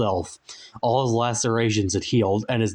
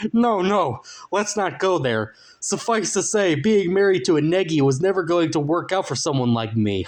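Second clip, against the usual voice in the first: higher, laughing falsetto anecdote; Safari Man voice; 7 semitones higher than usual. A narrator's reading. Safari Man tells of his marriage, higher. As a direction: brush it off with a cackle.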